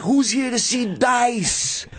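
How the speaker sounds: high, stretched announcer-style call; imitating Dice. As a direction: coach the opener with a sample rallying cry. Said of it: "And then be like".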